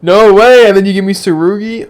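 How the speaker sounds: loud, high disbelieving shout; excited shout. Real, not acted. Gamer yelling at a surprise character, loud and excited. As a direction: yell it in happy disbelief. Reacting loudly to game.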